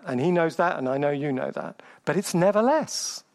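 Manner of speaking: ordinary talk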